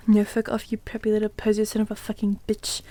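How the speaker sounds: whispered disgust, under the breath; disgusted mutter. Acted. Vampire cursing the janitor under his breath in a whisper. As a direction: hiss it so nobody hears. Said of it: "said under his breath in a disgusted way".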